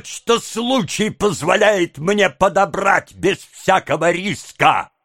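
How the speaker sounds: high, slow, frenzied tirade; furious high-pitched outburst, words dragged out; beside oneself with rage. A narrator's reading. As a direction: rant at high pitch, stretching every phrase. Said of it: "потеряв голову от бешенства".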